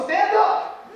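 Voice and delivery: high, loud, laughing stand-up order; hammed-up teacher shout; mock teacher yell. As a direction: play the bossy teacher barking the class up with a laugh. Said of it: "she goes".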